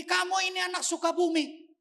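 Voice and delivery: high, loud cutting reveal; father in a rage telling his son he's adopted; angry father. Retold or imitated. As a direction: spit it at the boy in anger. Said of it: "Tiba-tiba lagi marah dia".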